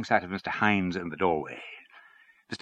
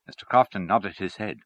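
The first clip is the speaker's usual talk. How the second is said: ordinary delivery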